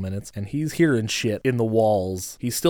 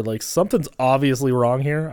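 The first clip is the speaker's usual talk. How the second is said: ordinary talk, nothing marked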